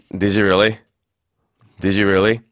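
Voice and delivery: doubt it, keep "Did you really" down. Shown as a demonstration. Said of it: "내려서 얘기하면은 조금 비꼬는 느낌".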